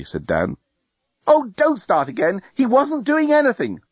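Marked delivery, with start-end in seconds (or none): 1.3-3.8 high-pitched, irritated protest; exasperated. Acted. Woman defending herself to her partner, exasperated. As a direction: cut off the accusation, pitch climbing. Lucy snaps back in a quarrel.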